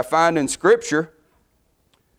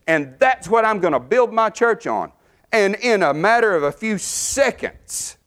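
high, ringing preacher's climb; fervent preaching; 8 semitones higher than usual. Acted. Preacher pressing the congregation on Peter's rebuke: fervent. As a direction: drive it home, lifted and urgent.

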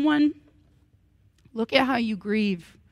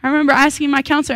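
usual speaking voice

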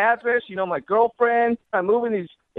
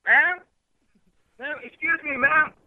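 raised voice calling across the street; 5 semitones higher than usual. Real, not acted. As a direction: call out to a stranger. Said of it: "The quicker that you yell at her".

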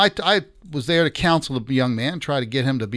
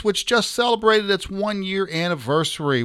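ordinary talk

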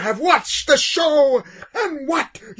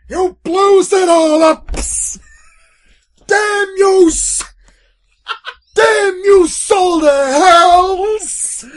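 loud anguished yell with extra S sounds; mock despair with added S's; Futurama plumber voice; 8 semitones higher, 8 dB louder, slower than usual. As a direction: tack an S on the words, shouting. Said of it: "add unnecessary S's at the end".

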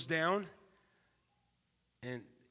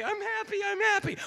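much higher and louder, singsong; mock happy voice; 10 dB louder than usual. Preacher mimics a feelings-driven person, high and giddy. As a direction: chirp it like a giddy child.

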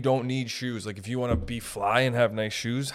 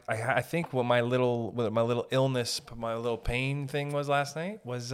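usual speaking voice

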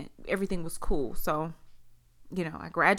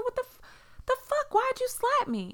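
much higher pitched, outraged disbelief; shocked squeal. Retold or imitated. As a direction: squeak it out in stunned hurt. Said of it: "I said".

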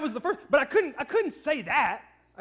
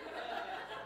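wheezing unvoiced laugh; silent breathy laugh; 13 dB quieter than usual. Real, not acted. Husband laughing mid-explanation, breathy and silent. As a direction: laugh without voice.

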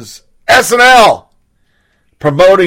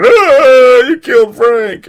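mock crying; 6 semitones higher, slower than usual. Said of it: "Gigi would just start crying".

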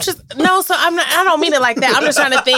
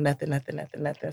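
sulky quiet; 3 semitones lower, 11 dB quieter, faster than usual. Retold or imitated. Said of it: "And I'm".

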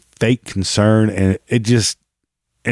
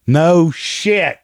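sarcastic; 8 semitones higher than usual. Real, not acted. Sarcastic reply to clip.

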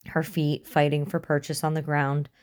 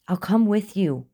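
quick, higher-pitched offer; said quickly; 5 semitones higher, 4 dB louder than usual. A narrator's reading. Bargaining for his friend's release, said quickly. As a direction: blurt it out in a higher voice. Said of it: "William said quickly".

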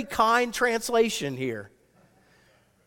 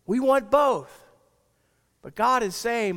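ordinary talk, nothing marked

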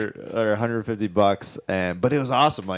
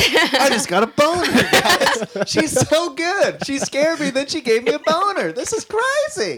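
laughing; 4 dB louder than usual. Real, not acted. Laughing excited reaction.

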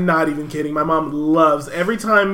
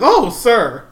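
surprised; 6 semitones higher, 6 dB louder than usual. Retold or imitated. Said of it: "they'd be like".

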